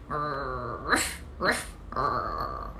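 low, soft mock growling and ruffs; angry dog growl. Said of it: "dog goes angry and he goes like".

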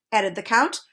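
ordinary delivery, nothing marked

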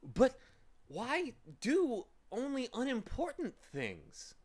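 put-on high, slow puzzled boy; young boy. A narrator's reading. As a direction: ask like a curious child. Milo asks, high child voice.

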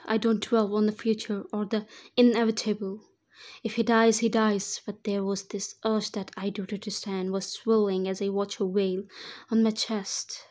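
plain reading voice

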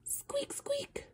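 tiny whispered mouse squeak. Acted. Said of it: "All I know is mice go".

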